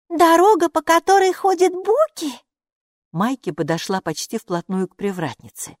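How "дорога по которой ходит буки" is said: high, loud, eager question; high-pitched curious little creature; excited little character. A narrator's reading. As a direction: pipe it up high in wonder. Maika questions the gatekeeper.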